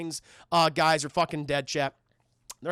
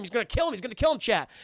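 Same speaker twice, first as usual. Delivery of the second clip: ordinary talk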